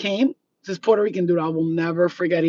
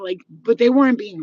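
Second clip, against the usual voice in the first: ordinary talk, nothing marked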